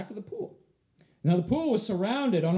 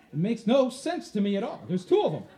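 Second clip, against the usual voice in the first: ordinary talk, nothing marked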